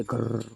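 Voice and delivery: long rolled grinding "grrr"; drawn-out rattling door noise; imitated door creak. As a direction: mimic a door grinding open. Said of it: "दरवाज़ा खुलने की आवाज़".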